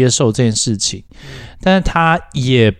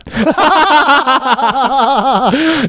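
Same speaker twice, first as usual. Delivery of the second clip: long high real laugh, falling away; long burst of laughter; 14 semitones higher than usual. Real, not acted.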